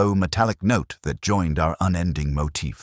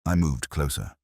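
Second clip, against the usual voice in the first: ordinary delivery, nothing marked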